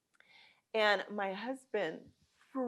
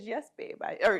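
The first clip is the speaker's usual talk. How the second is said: ordinary talk, nothing marked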